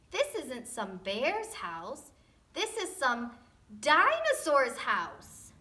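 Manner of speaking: slow, building to a loud dinosaur's house; slow dawning realization. Acted. Goldilocks realizing aloud whose house she is in. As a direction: spell out the discovery, rising.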